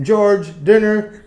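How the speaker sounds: mimicked parent summoning a kid; parent calling out. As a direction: holler for your child down the street. Another parent calls a child.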